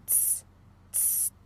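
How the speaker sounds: "tss" as a bare soft hiss; TS sound. Shown as a demonstration. Teacher isolating the German Z, soft bare "tss". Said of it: "som de T S".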